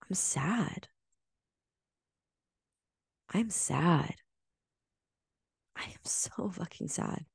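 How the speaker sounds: hushed, broken whisper of sadness. Retold or imitated. At the mirror admitting her sadness, whispered. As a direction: whisper it to yourself, barely voiced. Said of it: "I said".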